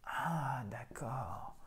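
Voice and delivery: whispered, drawn-out mock realisation; hushed realisation. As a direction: play the pupil who gets it, very softly. Acted pupil suddenly understanding. (chuchoté, comme un élève qui comprend)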